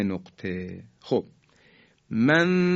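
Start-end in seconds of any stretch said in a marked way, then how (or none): 2.1-2.8 «منثوراً» with a long hummed noon before the ث; ikhfa. Shown as a demonstration. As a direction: hold the hidden n of «منثوراً» higher, on a level note. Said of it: "تنوین اگر برسن به حرف".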